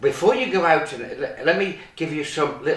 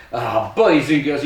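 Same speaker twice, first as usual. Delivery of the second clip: ordinary delivery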